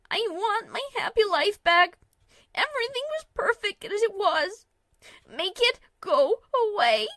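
high, loud wailing plea; desperate wailing. A narrator's reading. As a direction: wail in despair.

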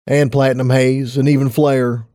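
ordinary delivery

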